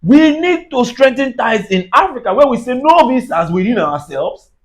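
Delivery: usual speaking voice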